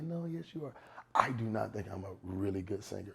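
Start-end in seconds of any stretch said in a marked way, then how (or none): 0.0-0.7 high, softer imitation of a fan; coaxing reassurer. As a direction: mimic someone insisting on a compliment, pitched up. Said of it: "for people to be like".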